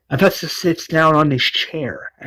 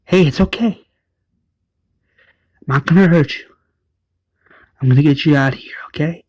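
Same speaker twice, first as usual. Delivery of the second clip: soothing; 3 semitones higher, much faster than usual. Retold or imitated. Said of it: "Avesta's like".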